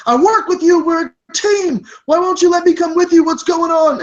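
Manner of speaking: high, loud indignant pleading; yelling. Acted. Waiter shut out by the team, yelling. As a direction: yell it high, demanding to be let in. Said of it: "And he's yelling like".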